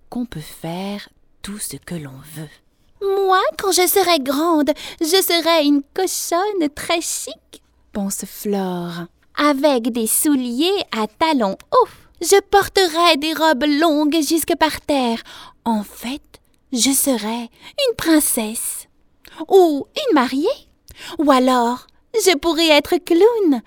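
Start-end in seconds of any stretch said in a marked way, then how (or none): 3.0-7.6 put-on high, loud child's voice; daydreaming little girl. A narrator's reading. Piglet alone at home imagines being grown up, high child voice. 9.4-23.6 put-on high, loud child's voice; daydreaming little girl. A narrator's reading. Piglet alone at home imagines being grown up, high child voice.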